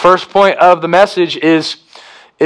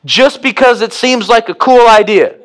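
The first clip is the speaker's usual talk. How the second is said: higher, jokey voice with a laugh; mock casual; 7 semitones higher than usual. Preacher voicing Jesus ironically, higher and jokey. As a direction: play it as a flippant joke. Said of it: "Jesus goes".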